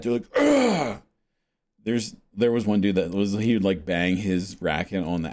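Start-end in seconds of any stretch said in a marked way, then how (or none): 0.4-1.0 high, exaggerated groan after a bad shot; mock frustrated grunt. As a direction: imitate an overcompetitive player's outburst. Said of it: "when they hit it bad".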